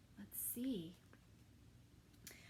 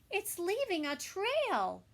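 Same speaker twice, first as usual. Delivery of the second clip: high, loud call to look; children pointing out the trail; excited shout; 9 semitones higher, 12 dB louder than usual. Acted. Children calling out at the trail, high and loud.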